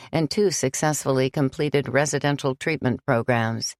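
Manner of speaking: calm, reassuring patron; benefactor's letter voice. Acted. Mr. Knightley writing back to Sam, calm and measured.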